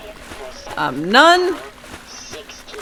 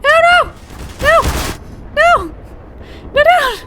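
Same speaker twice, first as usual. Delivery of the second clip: frustrated yelling; much slower than usual. Real, not acted. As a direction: yell it out in frustration. Losing the match, yelling.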